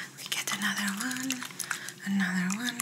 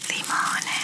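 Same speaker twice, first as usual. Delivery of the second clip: whisper in ear; 5 dB louder than usual. Shown as a demonstration. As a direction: close whisper right into the ear. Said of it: "Okay, again".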